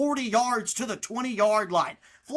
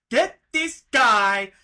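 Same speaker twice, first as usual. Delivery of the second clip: shouting; 10 semitones higher, 6 dB louder than usual. Real, not acted. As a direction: bellow it in frustration. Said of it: "that was really loud".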